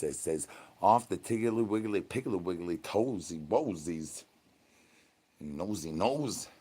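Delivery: low hushed reading; hushed aside. Real, not acted. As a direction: drop into a quiet aside. Reading chat, voice drops.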